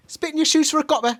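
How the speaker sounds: high, fast cartoon British accent; squeaky mock Cockney urchin; South Park English. Podcaster doing a cartoonish South Park-style British accent. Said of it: "South Park English".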